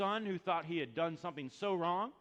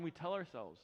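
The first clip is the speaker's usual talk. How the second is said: usual speaking voice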